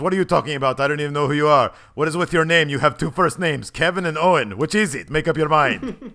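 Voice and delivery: loud, high, indignant wrestler rant; Rusev impression. Rusev confronting Kevin Owens, loud indignant impression. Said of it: "And Rusev says".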